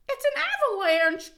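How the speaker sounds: high, loud alarmed cry; cried out. Acted. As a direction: yell the warning. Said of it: "cried Toad".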